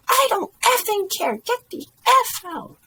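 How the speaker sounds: high, loud yell; enraged woman. A narrator's reading. Woman orders dad out of the hall, yelling angrily. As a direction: yell him out of the room, high-pitched.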